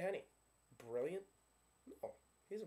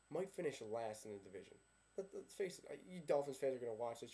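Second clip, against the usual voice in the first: ordinary talk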